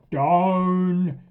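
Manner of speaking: loud, harsh "Down." bark; barked command. Retold or imitated. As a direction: bark it like an impatient owner. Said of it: "the first thing they do is".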